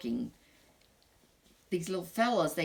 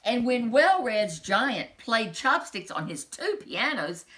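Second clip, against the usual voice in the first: ordinary delivery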